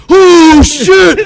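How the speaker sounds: loud shocked high cry; shocked exclamation. Retold or imitated. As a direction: jump out of your skin at the sight. Friend sees her punch him.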